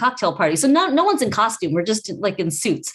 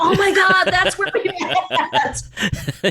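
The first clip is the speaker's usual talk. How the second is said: high-pitched, laughing burst of recognition; hammed-up delighted recognition; retold excited realization; 10 semitones higher, much slower than usual. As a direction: act out suddenly remembering, drawing it out into laughter. Retold moment he suddenly remembers.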